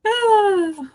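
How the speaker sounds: loud, high exhale; heavy sigh. Real, not acted. Parent finishing her rant to the webinar, loud relieving sigh. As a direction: let out a big sigh of release. Sighs after venting.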